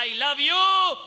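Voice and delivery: high, loud call of love; child calling out. Retold or imitated. Child calls out love to the hills. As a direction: call it to the mountains. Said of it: "जा करके बोला".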